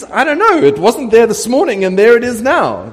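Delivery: high, loud, hammed-up innocence; mock bafflement. Builder explaining the sudden temple to a foreign king, mock baffled. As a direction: protest that it is a total mystery to you.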